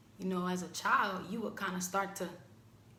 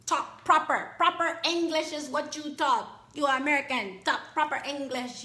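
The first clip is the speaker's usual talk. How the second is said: loud, high scolding voice; grandma scolding; 7 semitones higher, 9 dB louder than usual. Retold or imitated. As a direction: lay down the law like a strict grandma.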